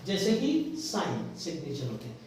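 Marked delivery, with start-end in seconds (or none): 0.8-1.4 साइन said "sine", straight from vowel to n; silent g. Shown as a demonstration. Teacher giving students an example word, silent g. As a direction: leave the g out of साइन. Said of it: "नहीं करते हैं".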